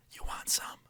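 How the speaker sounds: secretive, voiceless whisper; imitated whisper. Classmate secretly offering pills in class, whispered. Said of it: "well, whisper talking".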